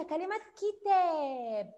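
كتاب with the ا of كتاب held very slowly and loud; teacher stretching كتاب; stretched long vowel. Shown as a demonstration. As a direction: draw out كتاب for children. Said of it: "كتاب مد ايه".

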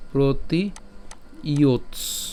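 "ইয়োৎস" ending on the bare dental t; careful pronunciation. Shown as a demonstration. Teacher repeating the syllable for students. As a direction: say "প্রতি ইয়োৎস" with the t cut short. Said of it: "উচ্চারণটা যদি আমরা করি".

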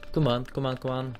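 soft, slowing, pleading mutter at the remote; frustrated muttering. Retold or imitated. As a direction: coax the remote under your breath. Pressing broken remote control.

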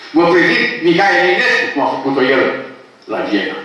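usual speaking voice

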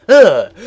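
loud, high disgusted grunt; retold disgusted ugh. Girl coughed on by an old man, loud disgusted ugh. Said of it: "and she just goes".

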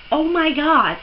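usual speaking voice

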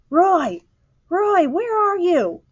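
high, drawn-out searching call; crying out. A narrator's reading. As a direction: call out for a missing child. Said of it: "cried Missus Eberhardt".

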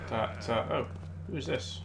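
ordinary talk, nothing marked

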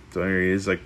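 usual speaking voice

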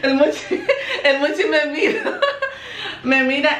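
real giggles breaking up the sentence; giggling. Real, not acted. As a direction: crack up while talking. Cracking up as Muchi watches her.

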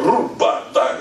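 quick high unvoiced noise-making; mock toilet noises. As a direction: imitate the bathroom sounds playfully.

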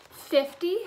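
high, loud shocked gasp of a word; a sharp rising gasp. A narrator's reading. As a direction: gasp it out in disbelief. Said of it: "Harry gasped".

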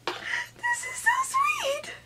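very high, soft, slow tearful squeak; tearful, touched. Real, not acted. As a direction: squeak it out, overcome by happy tears. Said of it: "you already made me cry".

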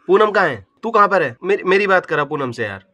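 ordinary talk, nothing marked

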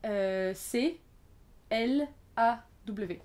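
letters dictated slowly, one by one; spelling out. Shown as a demonstration. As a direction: spell it out with long pauses between letters. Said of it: "Alors ça s'écrit". (épeler lentement, lettre par lettre)

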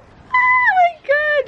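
high, drawn out and slow; stretched thrilled cry over her gift; held-back scream. Real, not acted. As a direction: squeal it long and high. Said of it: "I feel like I can't scream".

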